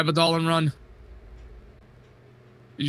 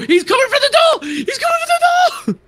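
screaming, much higher and louder; panicked shout; 7 dB louder than usual. Real, not acted. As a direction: yell it in panic. Chased in horror game.

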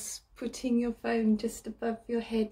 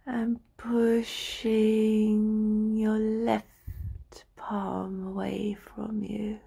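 slow meditation voice with drawn-out words and long pauses; guided relaxation; 4 dB louder than usual. Acted. Practitioner talking a listener into lying down and stretching, slow guided meditation. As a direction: guide them through the body slowly, stretching each phrase.

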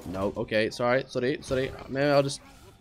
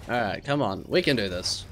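ordinary delivery

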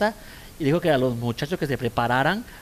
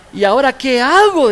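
mock exasperated cry; 10 semitones higher, 12 dB louder than usual. Yelling mother checks herself.

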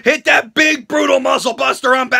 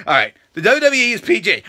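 ordinary delivery

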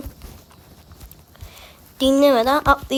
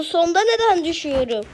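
frustrated; 5 semitones higher than usual. Real, not acted. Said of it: "Abi sinirlerim bozuldu".

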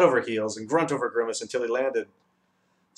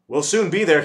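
bright, louder and higher; happy announcement; 6 semitones higher, 9 dB louder than usual. A narrator's reading. The Dodecahedron cheerfully tells the travellers, bright and loud. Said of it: "he announced happily".

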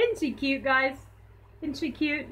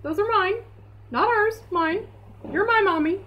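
high mock-indignant dog voice; voicing the dog; 8 semitones higher than usual. Owner voicing her dog Lulu, higher, claiming the sticks. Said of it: "Lulu would be like".

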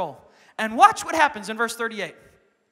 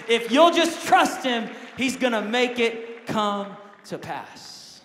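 preaching; 3 semitones higher, 6 dB louder, much slower than usual. Real, not acted. Preacher urges the church to stay in sync, slow and loud. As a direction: drive the point home from the pulpit. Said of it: "preaching at full force".

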